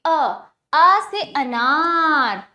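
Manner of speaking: "अ, आ से अनार" with each vowel stretched slowly; drawn out vowels. Shown as a demonstration. Teacher to children, slowly modelling "अ, आ से अनार". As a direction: sound out "अ" and "आ" slow and open-mouthed. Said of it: "आप मेरा मुख देखिए".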